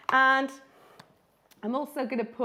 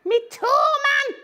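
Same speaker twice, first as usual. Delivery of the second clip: much higher, louder pained cartoon yelp, slow; squashed robin yelping; 8 dB louder, much slower than usual. Robin being squashed cries out in pain. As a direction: cry out like a bird whose toe got squashed. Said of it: "He's going".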